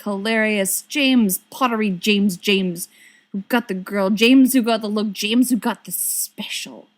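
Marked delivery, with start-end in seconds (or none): none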